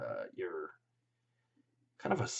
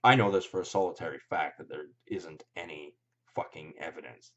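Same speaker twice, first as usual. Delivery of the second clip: usual speaking voice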